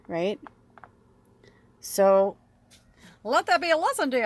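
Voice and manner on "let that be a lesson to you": mock stern lecture.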